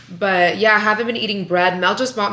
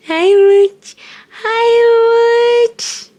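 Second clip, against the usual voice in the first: high, louder squealed hello; 9 dB louder, much slower than usual. Real, not acted. Mother greeting her baby with a high excited squeal.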